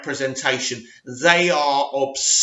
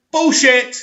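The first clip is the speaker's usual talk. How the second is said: higher, louder scoff of disbelief; exploding at the article; scornful outburst; 7 semitones higher, 4 dB louder than usual. Real, not acted. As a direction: call out the claim with a loud scoff.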